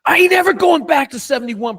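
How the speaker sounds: high, loud, determined; loud vow. Retold or imitated. Kicker vowing to his coach, loud and high. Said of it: "He's like".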